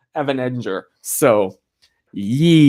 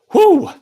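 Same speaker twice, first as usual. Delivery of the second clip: relieved exhale; 7 dB louder than usual. Real, not acted. Recovering after coughing fit.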